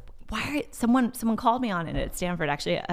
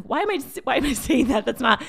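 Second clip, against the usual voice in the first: baffled; 7 semitones higher, 7 dB louder than usual. Retold or imitated. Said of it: "I'm like".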